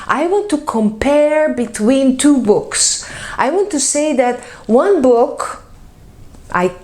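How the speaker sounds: ordinary talk, nothing marked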